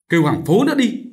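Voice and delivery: high, loud seething taunt. A narrator's reading. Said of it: "rồi hầm hầm". (giọng hầm hầm thách thức)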